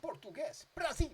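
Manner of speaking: Brazil shout. Pretend. Naming the song's origin, a hammed-up Brazil cheer. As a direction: belt it out like a cheering fan. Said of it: "I'm like".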